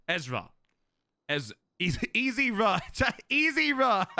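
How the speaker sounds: breaking into real laughter; laughing. Real, not acted. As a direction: crack up while saying it. Cracks up at misread name.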